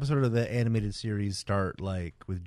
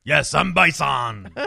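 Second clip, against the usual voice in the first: loud, drawn-out villain cry; hammed-up villain quote; 3 semitones higher, 7 dB louder, slower than usual. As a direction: stretch it out like a triumphant movie villain. Film villain quote after Street Fighter.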